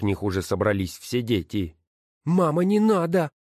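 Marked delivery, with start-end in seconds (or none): 2.2-3.3 put-on high child's voice, a bit louder; child pleading. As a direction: plead with mother to stop. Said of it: "сказал Саул". (высокий детский голос, умоляюще)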